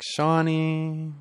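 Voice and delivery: high, sing-song call from upstairs; mom calling out. Retold or imitated. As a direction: call the boy's name like a mother upstairs. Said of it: "my mom calling me from upstairs".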